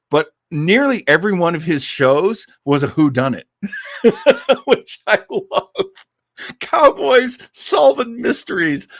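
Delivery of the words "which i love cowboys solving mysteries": high, laughing delight, slowed down. Real, not acted. Host gushing to listeners about cowboy mysteries, laughing high. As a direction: giggle through it, tickled pink.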